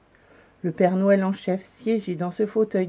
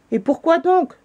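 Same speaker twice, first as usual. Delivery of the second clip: puzzled; 10 semitones higher, 6 dB louder than usual. A narrator's reading. Chief questions the refusal.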